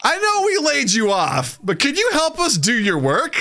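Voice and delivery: high, whiny imitation of a clueless boss; mock boss voice. Mocking the boss asking a fired worker for help, high mock voice. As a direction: mimic the boss in a high nagging tone.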